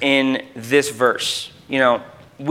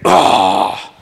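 low, loaded, over-pious groan; mock-spiritual "oh"; 9 semitones lower, 3 dB louder than usual. Preacher showing how spiritual the verse sounds, mock-pious groan. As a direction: heave out a deep, pious "oh". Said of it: "Sounds very spiritual".

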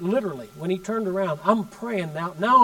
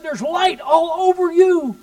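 higher, louder and slow, retelling the man's amazement; awestruck cry; 8 semitones higher, 5 dB louder, slower than usual. Retold or imitated. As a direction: cry it out like a man seeing a vision. Man freaking out over light.

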